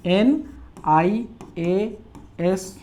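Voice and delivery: "आई ए एस" very slow, with gaps; letter by letter. Shown as a demonstration. Teacher showing the first sound, "आई ए एस" slowly spelled out. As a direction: stretch and separate each letter of "आई ए एस". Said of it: "कैसे बोल रहे हैं".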